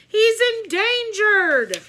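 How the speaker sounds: high loud drawn-out plea; yell. A narrator's reading. Miss Sookie protecting her pet, a loud slow plea. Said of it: "yelled Miss Sookie".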